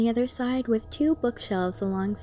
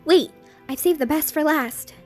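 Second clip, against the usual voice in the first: Twilight voice; 7 semitones higher, 7 dB louder than usual. Acted. Twilight speaks in story.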